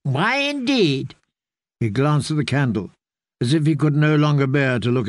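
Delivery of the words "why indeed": put-on higher old man, chatty and earnest; an old caretaker explaining gently; lightly voiced old man telling the tale; old man's voice. Grey man, rueful.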